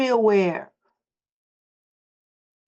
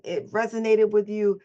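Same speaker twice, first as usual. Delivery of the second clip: ordinary delivery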